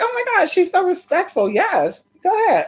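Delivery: high, sugary, fake-impressed; gushing. Gushing at the wife's politeness, high sweet voice. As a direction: gush over how polite she is. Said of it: "I was like".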